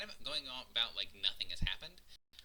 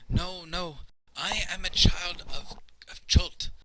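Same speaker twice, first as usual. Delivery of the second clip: high, loud, frightened servant voice; afraid, protesting; 9 semitones higher, 7 dB louder, slower than usual. Acted. Frightened servant rejects talk of freedom, afraid and protesting. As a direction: protest in a raised, fearful voice. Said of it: "very afraid".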